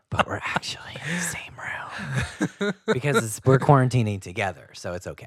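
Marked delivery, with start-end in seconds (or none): 0.1-3.4 laughing whisper. Real, not acted. Laughing aside.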